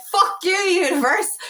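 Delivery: high, drawn-out mock-furious curse; hammed-up angry outburst, pitch jumping up; really angry. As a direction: act out cursing the universe in a rage. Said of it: "Really angry".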